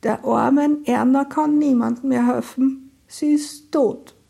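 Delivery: high, fragile, slowing at the end; cracked, high voice with a slow last phrase; brittle voice. Acted. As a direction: break the bad news in a frail voice. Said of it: "Ammas Stimme klang brüchig".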